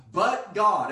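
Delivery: high, loud "But God" to rouse the crowd; loud call. Real, not acted. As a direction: call it out for the congregation to echo. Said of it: "Everybody say".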